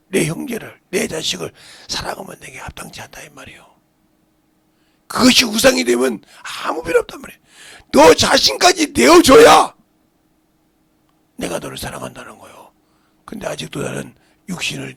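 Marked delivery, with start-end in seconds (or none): 5.1-9.7 high, loud exhortation; full-force preaching. Real, not acted. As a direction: drive the demand home from the pulpit.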